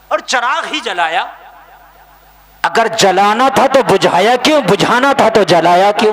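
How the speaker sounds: loud, challenging rhetorical questions; high, forceful sermon delivery; full-force preaching. Real, not acted. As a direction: hurl both questions at the crowd.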